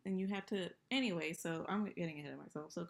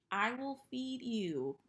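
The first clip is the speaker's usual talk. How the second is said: high, slow coo; slow sweet voice to pet; 7 semitones higher, 5 dB louder, slower than usual. Real, not acted. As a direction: coo it to the turtle. Talking to her turtle.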